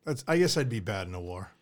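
self-deprecating laugh. Real, not acted. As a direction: laugh at your own admission. Laughing at himself.

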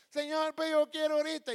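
put-on high, loud childish-style pleading; impatient mock demand. Believer demanding an answer from God now, loud impatient plea. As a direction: whine it loudly at heaven.

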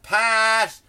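loud, high shout of a name; yelled call. Pretend. Yelling out in a crowded mall, loud call. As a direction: holler it across a crowded place. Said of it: "just yell out".

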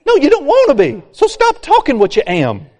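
usual speaking voice

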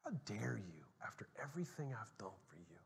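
hissed whisper of retold rage; furious whisper. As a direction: whisper the fury he felt back then. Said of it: "I was furious".

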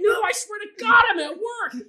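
very high, loud, slow plea; desperate shout. Retold or imitated. Pleading again, louder.

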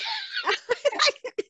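high burst of real laughter; bright giggle. Real, not acted. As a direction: laugh at your own joke. Laughs at her own story.

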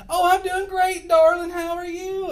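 very high, loud, sugary put-on cheer; fake sweet reply. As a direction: mask anger with gushing sweetness. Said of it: "She goes".

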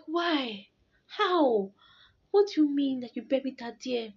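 put-on high, disbelieving child's questions; retold shocked questioning.

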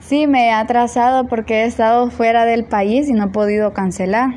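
normal talking voice

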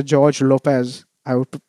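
ordinary talk

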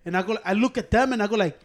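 usual speaking voice

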